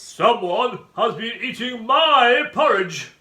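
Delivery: booming, slow bear growl; great big growly voice. A narrator's reading. Father Bear finding his porridge eaten, great growly voice. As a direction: roar it slowly as a huge bear. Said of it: "in his great big growly voice".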